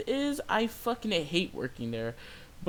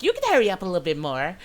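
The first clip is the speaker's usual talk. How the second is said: quick, louder mock customer voice; pushy Karen; 3 semitones higher, 5 dB louder, faster than usual. Customer pressing the cashier to speed up, pushy. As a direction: imitate her rushing you, fast and forceful. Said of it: "She's just like".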